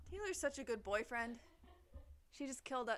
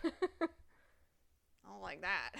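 drawn out; 7 dB louder, much slower than usual. Real, not acted. Spooked, drawn out.

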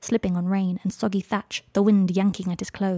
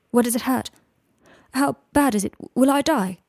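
higher, louder panicked questions; frightened questions; 5 semitones higher, 5 dB louder than usual. A narrator's reading. Draco with eyes shut, frightened. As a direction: ask in rising fear.

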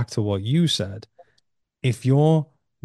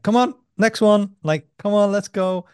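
eager urging; 10 semitones higher, 4 dB louder than usual. Retold or imitated. Urging a part of himself.

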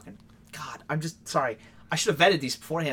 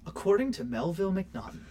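normal talking voice

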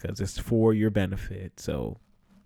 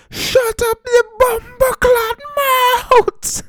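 put-on much higher and louder West Indian accent, slow and furious; hammed-up West Indian shout, much higher and louder; imitated West Indian yell; 9 dB louder, much slower than usual. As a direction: yell as the enraged West Indian gym teacher. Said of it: "My West Indian accent is very bad".

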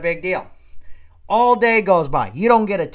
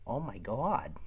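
stunned; 5 semitones lower, 13 dB quieter, slower than usual. Retold or imitated. Acted stunned husband after outburst.

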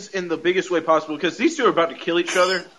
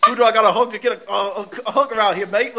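fast, higher-pitched joke Australian; mock Australian accent; 5 semitones higher, faster than usual. Podcaster mocking Wanda's supposed brother, mock Australian accent. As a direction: put on a rough Australian impression, quickly. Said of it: "this dude's talking all Australian and shit".

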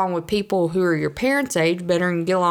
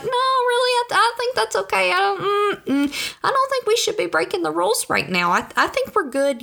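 much higher, squeaky, hesitant protest; nervous goody-two-shoes. Retold or imitated. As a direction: squeak out a timid refusal. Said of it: "I'm like".

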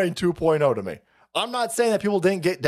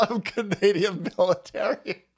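laughing mock quote. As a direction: repeat it while cracking up. Repeats caller's claim while laughing.

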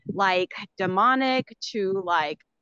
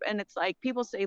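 ordinary talk, nothing marked

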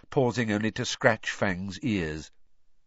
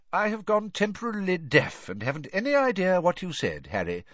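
higher-pitched, airily innocent headmaster; Dumbledore feigning ignorance; 9 semitones higher than usual. A narrator's reading. Headmaster excusing a pupil's rudeness, airily innocent. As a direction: play the wise old headmaster feigning deafness.

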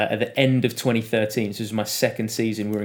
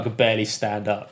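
ordinary talk

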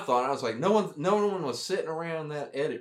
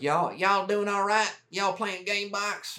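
high, slow put-on Texan drawl; imitating Texan first sergeant; 9 semitones higher, slower than usual. First sergeant checking on smoking soldiers, high slow Texan drawl. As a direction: lay on a high, slow Texas twang. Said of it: "he was like".

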